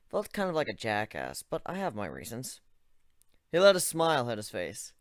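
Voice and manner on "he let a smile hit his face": ordinary delivery, nothing marked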